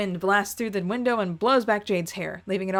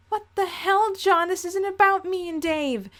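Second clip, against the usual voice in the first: put-on higher, offended young woman's voice; indignant Jade; 10 semitones higher than usual. Jade snapping back at John, higher and indignant. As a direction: lift the voice, stung and defensive. Jade's voice in the argument.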